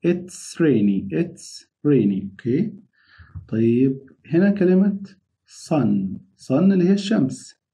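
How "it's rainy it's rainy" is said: it's rainy very drawn out; slow drill; slow model phrase. Shown as a demonstration. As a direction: stretch each word of it's rainy. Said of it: "هنا يا أبطال".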